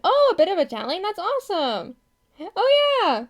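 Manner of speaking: high, excited exclamation; light up at happy news; thrilled reaction, voice leaping up; delighted surprise. Real, not acted. Delighted by chatter's languages.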